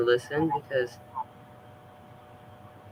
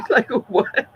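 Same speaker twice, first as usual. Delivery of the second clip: laughing; 11 semitones higher, 5 dB louder than usual. Real, not acted. Laughing at own story.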